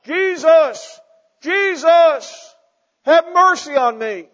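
desperate high shout to a crowd; a blind beggar yelling for Jesus, desperate shout. Acted. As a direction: cry out with everything you have. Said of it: "he just starts shouting in the direction of the crowd".

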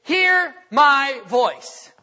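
very slow and loud, every word weighted; a father to his kids when all else fails; firm father's voice. Acted. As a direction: lay down the law, word by word. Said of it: "when all that fails, it's".

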